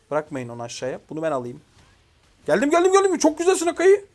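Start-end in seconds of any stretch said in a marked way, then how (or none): 2.5-4.0 very high, loud, rapid cheering; excited shout. Real, not acted. Gamer rushing into the fight and praising Akai, thrilled. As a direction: cry out as you rush in to help. Rushes in, cheering a teammate's play.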